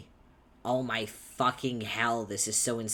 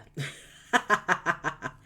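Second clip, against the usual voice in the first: loud breaking laugh; real laugh breaking through the scene; burst of laughter; 8 semitones higher, 8 dB louder than usual. Real, not acted. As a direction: crack up at your own flubbed line. Breaks up over the slip.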